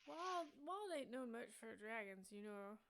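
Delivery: a hint of a simple city watchman; dim guard. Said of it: "he scratches under his helmet and he says".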